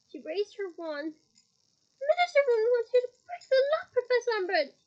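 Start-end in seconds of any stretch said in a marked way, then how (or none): 2.0-4.7 high, loud desperate cry; loud pleading cry. A narrator's reading. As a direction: shout it at her in alarm. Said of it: "cried Hermione".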